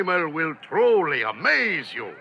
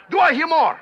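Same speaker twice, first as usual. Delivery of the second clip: loud auctioneer call; 7 semitones higher, 5 dB louder, faster than usual. Acted. Auctioneer calling out.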